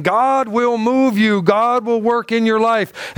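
slow, weighty preacher emphasis; slow preaching emphasis. Acted. Pastor to congregation, slow weighty preaching. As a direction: lean on each word like a preacher. Preacher slows to drive point.

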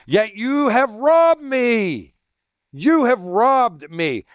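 slow, heavy stress on "robbed", then "You have robbed me" drawn out slowly; emphatic. Shown as a demonstration. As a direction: read it slowly as God's emphatic charge, leaning on "robbed". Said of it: "Exclamation point. Emphatic".

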